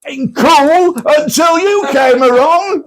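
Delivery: high, slow, half-laughing retort; stretched, high retort through laughter; laughing mock retort. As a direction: mimic the retort while cracking up. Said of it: "like this".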